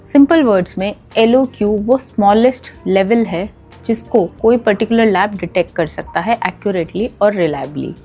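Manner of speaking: ordinary talk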